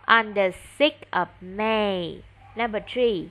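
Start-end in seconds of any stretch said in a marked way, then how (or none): 0.1-2.2 spelling out each word slowly for the pupil; slow and deliberate, every word apart; slow model answer. Shown as a demonstration. Teacher gives the pupil the answer, slowly and clearly. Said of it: "Đúng rồi".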